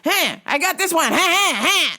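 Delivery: high, loud nagging hey buddy; pushy customer. Retold or imitated. Customer badgering a busy worker, high and loud. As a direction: pester like an impatient customer. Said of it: "he goes".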